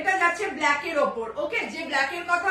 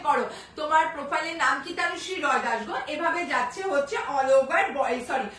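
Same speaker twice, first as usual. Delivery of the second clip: usual speaking voice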